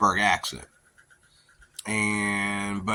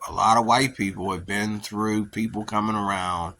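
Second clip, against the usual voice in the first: ordinary talk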